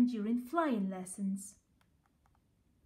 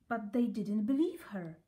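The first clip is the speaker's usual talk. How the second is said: ordinary delivery, nothing marked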